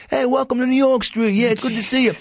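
high-pitched New York street heckler; yelling New York hawker. Retold or imitated. Park performer hailing passersby from a window, yelling New York greetings. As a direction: call out to passersby like a New Yorker. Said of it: "they'd yell at people who were walking by".

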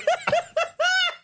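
squeaky laughing fit; high wheezing laugh. Real, not acted.

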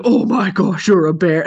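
loud startled dog voice; dog's realization. Retold or imitated. Storyteller voicing the dog realizing, startled. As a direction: gasp it like a shocked dog. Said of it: "he's like".

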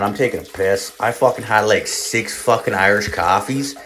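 ordinary talk

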